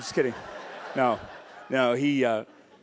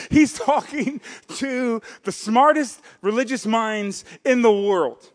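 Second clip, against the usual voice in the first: ordinary delivery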